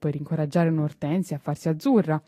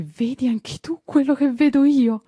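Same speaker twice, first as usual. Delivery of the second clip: higher, louder, awestruck question; amazed gardener; 7 semitones higher, 4 dB louder than usual. A narrator's reading. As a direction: ask the boy in wonder.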